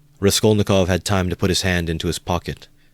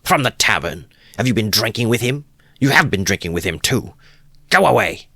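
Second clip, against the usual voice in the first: loud, higher accusing shout; angry dismissal; 6 semitones higher, 4 dB louder than usual. A narrator's reading. Wife shouts the guest out, loud and angry. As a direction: drive him out of the room.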